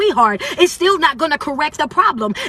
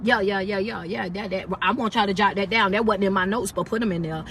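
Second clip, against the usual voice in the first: quiet aside; 7 semitones lower, 6 dB quieter than usual. Real, not acted. Aside to the live audience.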